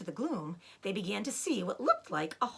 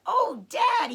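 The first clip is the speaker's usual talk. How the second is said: high, loud happy cry; young fox thrilled by his find; excited cry; 12 semitones higher, 8 dB louder than usual. Acted. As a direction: call out to Dad in delight. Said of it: "he cried out".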